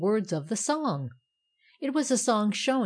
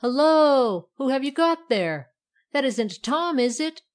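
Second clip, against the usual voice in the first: high, loud greeting turning to questions; surprised hail; 6 semitones higher, 7 dB louder than usual. A narrator's reading. Poker spotting a newcomer with the irons, surprised hail. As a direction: call out, then question who it is.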